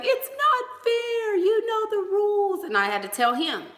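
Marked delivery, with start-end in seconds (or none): 0.0-2.6 put-on high-pitched, slow childish whine; whiny kid son. Son complaining to mom about losing at Uno, whiny. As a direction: mimic a six-year-old protesting. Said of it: "And he's like".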